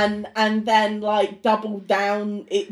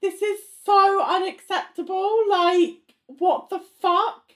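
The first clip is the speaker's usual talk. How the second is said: high-pitched exasperated objection; indignant; 8 semitones higher than usual. Real, not acted. Co-host objects to a boss's rudeness, indignant. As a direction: protest in open disbelief, drawing out the last words.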